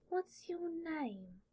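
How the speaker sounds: put-on high, slow, hushed boyish wonder; awestruck. Awed boy asking the name of the stranger beside him, high and slow. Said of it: "that is definitely awe in his voice".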